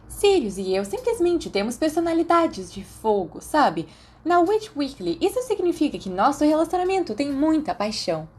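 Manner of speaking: a hint of a smug girlfriend showing off; lightly voiced gushing Mary. A narrator's reading.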